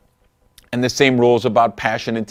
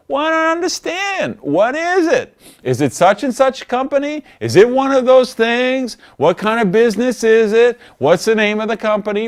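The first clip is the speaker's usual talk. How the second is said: high, insistent mock prospect firing questions; pushy questioning prospect; 10 semitones higher, 3 dB louder than usual. Sales coach mimics a nosy prospect, high and insistent. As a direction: play the nosy prospect pressing for answers.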